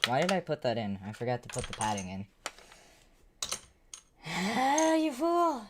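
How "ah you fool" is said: high, hammed-up ah, you fool; mock villain scold. Streamer mocking himself for forgetting the padding, hammy high voice. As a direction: shriek it like a cartoon villain.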